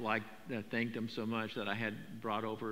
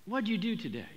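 laid-back California God; 6 semitones higher, 4 dB quieter than usual. Pretend. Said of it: "'cause he was from California".

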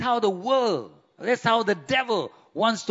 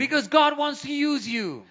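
preaching at full force; 5 semitones higher than usual. Acted. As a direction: rouse the congregation. Preaching climax, shout.

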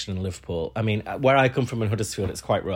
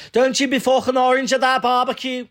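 shouted high mock dad telling off; mock angry dad; 14 semitones higher, 5 dB louder than usual. Comedian's dad yelling at his son about a barbecue. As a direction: bellow it like an outraged dad, pitched way up. Dad voiced in retold joke.

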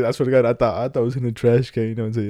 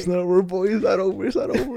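rising higher each time, teasing; mock dramatic; 8 semitones higher than usual. Friend teasing the storyteller, higher each repeat. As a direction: tease him, it's not over.

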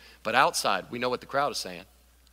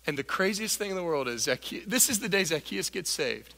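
normal talking voice

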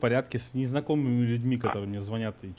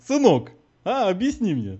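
higher, louder, mocking "son"; condescending; 7 semitones higher, 4 dB louder, slower than usual. Real, not acted. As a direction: talk down to him. Callee condescends to collector.

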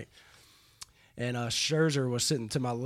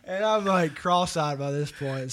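usual speaking voice

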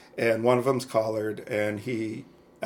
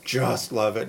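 ordinary talk, nothing marked

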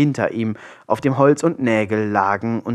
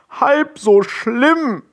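high, slow, aghast cry; horrified outcry; 12 semitones higher, much slower than usual. A narrator's reading. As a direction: throw the words back in horror. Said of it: "rief Arno entsetzt". (entsetzt ausgerufen)

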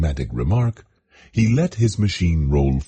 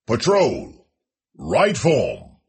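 stiff barking toy soldier; 7 semitones higher, much slower than usual. Acted. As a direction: bark it across the drill field. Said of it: "opened a cavernous mouth".

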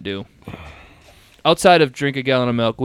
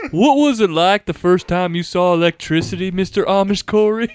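put-on Southern accent, a little higher; 4 semitones higher than usual. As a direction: play a folksy Southerner hosting a show. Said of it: "gonna do a southern one".

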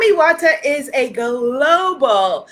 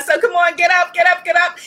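rousing call; 5 semitones higher, 5 dB louder, faster than usual. Real, not acted. Leader calling viewers to stand.

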